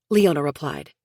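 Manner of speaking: ordinary delivery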